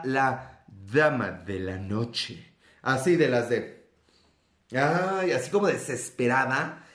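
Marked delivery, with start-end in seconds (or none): 0.0-2.5 low, slow sultry purr; low sultry lady of the night; sensual. Acted. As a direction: breathe it out seductively. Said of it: "empiezo a emocionarme de que habla así".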